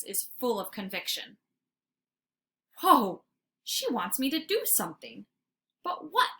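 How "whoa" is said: ordinary delivery, nothing marked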